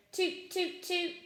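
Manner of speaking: high tooting; horn toots. A narrator's reading. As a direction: toot like a little horn. Mole playing his horn.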